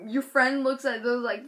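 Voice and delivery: ordinary delivery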